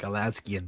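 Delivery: "Alaskan" said as a-LAS-kee-an; preferred variant pronunciation. Shown as a demonstration. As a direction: add an ee before the last syllable of "Alaskan". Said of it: "I think I like Alaskan better".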